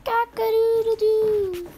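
high, slow crowing; mock rooster call. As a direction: crow it like a rooster.

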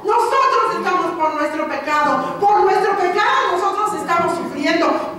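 loud, high rebuke of the other thief; scolding thief. Retold or imitated. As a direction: cry out the rebuke from the cross. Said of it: "el otro dice". (el ladrón reprendiendo con gritos)

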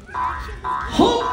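low, disdainful humph. A narrator's reading. Kangaroo interrupting Horton, humphing. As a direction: grunt a scornful humph. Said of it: "Humped a voice".

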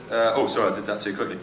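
ordinary delivery

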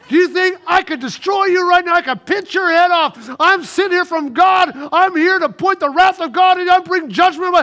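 mock angry Jesus threatening Zacchaeus; furious threat. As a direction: thunder down judgment at him. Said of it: "He didn't do nothing of that".

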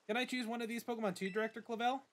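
lightly voiced eager rival girl; a touch of bubbly friendliness; Nemona. Nemona meets her new neighbor, lightly eager voice.

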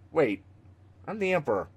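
ordinary talk, nothing marked

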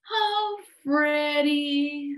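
put-on high, mournful little-boy voice; sighing sadly. A narrator's reading. Eddie confides in his teddy, sighing sadly. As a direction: sigh it out high and forlorn. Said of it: "with a great big sigh".

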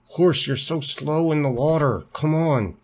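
high, complaining plea; exasperated whine. Real, not acted. Rider scolds horse slow in the water, exasperated.

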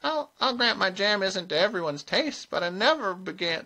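high, modest little voice; meek old witch, pitched high. A narrator's reading. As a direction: protest humbly in a small high voice. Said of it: "in a modest little tone".

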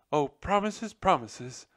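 high, loud despairing wail. A narrator's reading. Nutmeg scoffing at the general's promise, wailing high. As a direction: throw up your hands and wail it. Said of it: "Nutmeg wailed".

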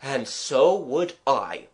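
slow, weighty agreement; with unction. Acted. Artist siding with the child, unctuous. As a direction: draw out each word with relish. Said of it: "he replied with unction".

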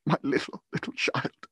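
grief-stricken near-whisper, voice giving out; breaking down. A narrator's reading. Father mourning his small child, breaking down. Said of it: "He broke down all at once".

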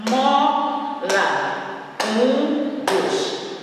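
"Mola" split into mo and la; two detached beats on "Mola"; syllable by syllable. Shown as a demonstration. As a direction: break "Mola" in half with a pause. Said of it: "foram duas vezes".

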